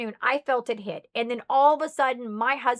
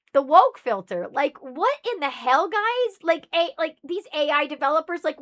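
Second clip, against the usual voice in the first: exasperated outburst; 5 semitones higher, 6 dB louder than usual. Real, not acted. Exasperated outburst on the woke filter.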